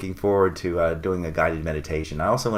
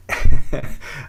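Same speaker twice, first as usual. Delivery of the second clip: brief, loud, higher-pitched chuckle; short amused laugh; 7 semitones higher, 11 dB louder than usual. Real, not acted. Host amused by the moon's name, short laugh. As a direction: let out a quick burst of laughter.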